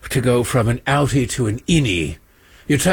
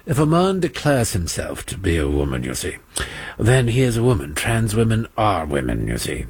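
mock British accent; 6 semitones lower than usual. Host mocking UK health secretary's old view, low pompous British accent. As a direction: play a stuffy Englishman lecturing on gender. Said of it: "no, that's not a British accent".

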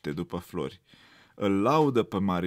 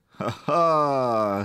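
loud jeering ha ha; bully cub laughing at the rabbit; hearty mocking laugh; 3 semitones higher, 6 dB louder than usual. A narrator's reading. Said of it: "râde cu poftă Marinucă".

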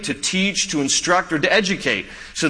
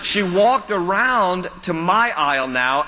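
ordinary talk, nothing marked

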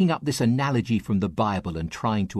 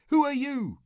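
high, startled question; a frightened hiker calling up, voice way up; hiker crying out. Acted. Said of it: "cried the hiker".

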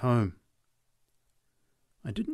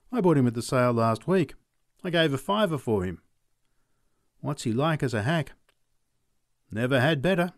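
higher, lively sales talk; horse seller; 5 semitones higher, 3 dB louder than usual. Acted. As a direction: sell the horse hard. McLeod praises the horse.

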